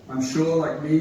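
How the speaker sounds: normal talking voice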